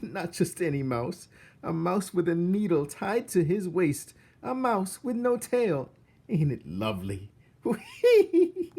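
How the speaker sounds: high, loud delighted cook. Acted. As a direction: gush with glee like the cook.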